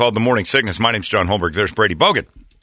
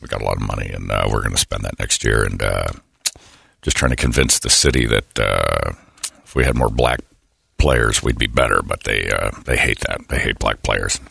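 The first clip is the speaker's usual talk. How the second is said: deep mock coach mumble; Belichick impression; 13 semitones lower than usual. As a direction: mumble like a gruff head coach. Impersonating Belichick planning.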